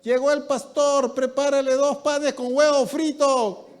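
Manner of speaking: high, loud call across the house; a mother calling her maid to make fried egg sandwiches. Retold or imitated. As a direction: summon the maid from the kitchen.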